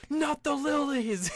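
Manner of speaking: high, loud, slow mock wail; hammed-up horror over trampled flowers; dad's anguished cry. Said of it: "his dad just goes out".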